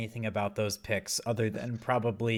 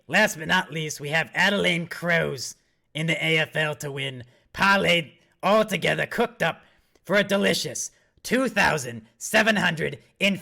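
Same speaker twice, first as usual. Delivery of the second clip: raspy smoker voice; 2 semitones higher, 4 dB louder than usual. Pretend. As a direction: rasp it out like a heavy smoker hyping his parlay. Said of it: "I sound like a smoker".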